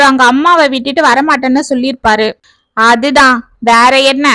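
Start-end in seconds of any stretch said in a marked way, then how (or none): none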